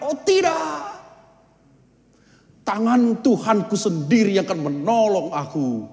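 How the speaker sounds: high self-assured pious man; smug refusal. Acted. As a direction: wave off the crew confidently. (orang saleh menolak dengan yakin)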